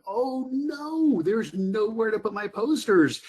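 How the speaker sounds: mock-frustrated whine; frustrated wail. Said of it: "just getting so frustrated, going".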